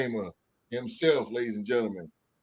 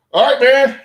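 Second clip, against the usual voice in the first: loud hype call to open; hype shout; 10 semitones higher, 12 dB louder than usual. Real, not acted. Host hyping the podcast crowd, loud call. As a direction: shout it out to get the show going.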